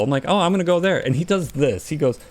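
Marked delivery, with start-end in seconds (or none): 0.3-1.1 eager decision. Retold or imitated. Eager quote in story.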